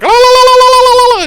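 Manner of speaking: high, loud war-cry trill; shrill la la la battle cry; Xena ululation. Retold or imitated. Buffy charging from behind with a loud Xena war cry. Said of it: "like Xena".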